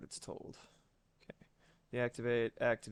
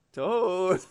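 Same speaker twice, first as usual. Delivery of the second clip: pet name; 7 semitones higher, 8 dB louder than usual. Real, not acted.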